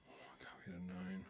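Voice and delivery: whispered to himself; hushed aside to himself at the table. Real, not acted. Poker streamer whispering over his cards.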